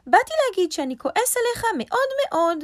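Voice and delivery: high, loud, cross little rabbit; angry rabbit. A narrator's reading. Rabbit tells lion he is very angry, high and cross. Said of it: "אני כועס נורא".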